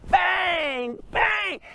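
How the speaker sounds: high, ringing spoken bangs; imitated hammer bangs. Thief yanking the slide hammer, high imitated bangs.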